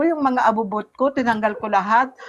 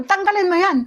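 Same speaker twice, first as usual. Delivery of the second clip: husband's sharp order; 8 semitones higher than usual. Retold or imitated. Husband ordering her to remove it.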